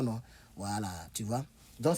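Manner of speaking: normal talking voice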